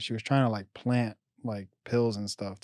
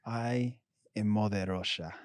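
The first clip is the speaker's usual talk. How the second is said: slow mock Russian accent; 3 dB quieter, slower than usual. Co-host riffing on an Overwatch character, slow Russian accent. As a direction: draw it out like a Russian caricature. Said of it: "I read it in a Russian accent".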